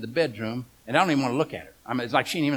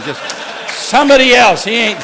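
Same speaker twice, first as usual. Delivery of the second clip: sudden shout, much higher and louder; mock divine outburst; 8 semitones higher, 14 dB louder than usual. God telling the wife to find another husband, shouted. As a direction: blurt it out as an exasperated God.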